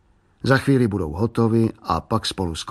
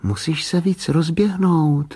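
put-on slow, higher-pitched little-girl voice; little girl advising; 6 semitones higher than usual. A narrator's reading. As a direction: urge a brother on like a small coach. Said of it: "radila v duchu holčička Bráškovi".

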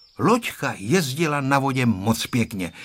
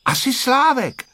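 put-on high, triumphant little-girl voice; a girl proudly supplying the answer, pitched high; 13 semitones higher, 4 dB louder than usual. A narrator's reading. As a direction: pipe up victoriously in a high child's voice. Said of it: "řekla vítězně Tereska".

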